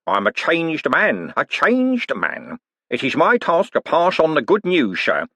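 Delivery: milk the piety for all it's worth; mock pious. Con man selling repentance to Moist, slow and sanctimonious. Cribbins preaching false piety.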